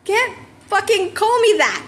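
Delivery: high, stretched, indignant shout; angry outburst. Acted. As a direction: protest hard, offended. Bakugo snaps over nickname.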